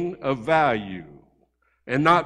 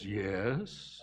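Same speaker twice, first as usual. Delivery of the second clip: put-on small, hushed child's hello; a timid kid picking up the phone, hushed; quiet little boy; 7 semitones lower, 14 dB quieter than usual. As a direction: answer softly like a shy little boy. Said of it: "real quiet and soft".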